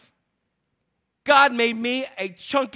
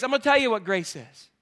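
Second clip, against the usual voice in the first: ordinary talk, nothing marked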